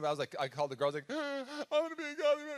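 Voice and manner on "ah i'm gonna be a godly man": high wailing sob-voice; mock crying. Pastor imitating himself crying on the phone to his girlfriend. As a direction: wail it out through tears, high-pitched. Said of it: "So I'm, like, crying".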